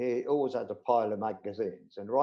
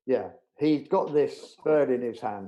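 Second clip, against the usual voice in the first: normal talking voice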